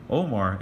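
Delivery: Omar with a throaty Ain, short O; Arabic Omar. Shown as a demonstration. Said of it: "is actually pronounced Omar".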